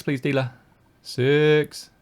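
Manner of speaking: ordinary delivery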